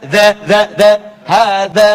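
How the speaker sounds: za sung out loud and held. Shown as a demonstration. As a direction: ring out za loudly. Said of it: "Kalau sa". (za diucapkan keras dan panjang)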